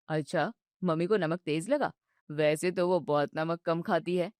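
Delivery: calm her down patiently; soothing husband. Acted. Husband soothes his upset wife, low and gentle.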